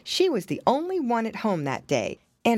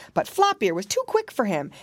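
ordinary delivery, nothing marked

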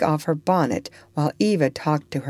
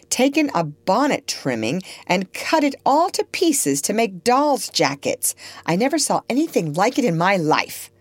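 higher-pitched indignant complaint; exasperated Miss Ophelia; 5 semitones higher than usual. Acted. Guardian reports the locked-up child's mischief, exasperated. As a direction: list each offence as a fed-up guardian.

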